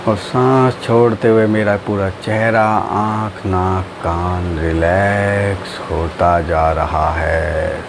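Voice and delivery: low, slow drawn-out meditation voice; guided meditation. Acted. Teacher guiding friends through breathing and relaxing, guided meditation.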